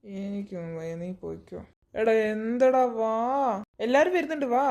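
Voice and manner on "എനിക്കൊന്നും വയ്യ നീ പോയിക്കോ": much softer and lower, half asleep; sleepy mumble. Acted. Sleepy friend refusing to get up. (ഉറക്കച്ചടവോടെ പിറുപിറുത്ത്)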